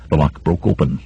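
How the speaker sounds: ordinary delivery, nothing marked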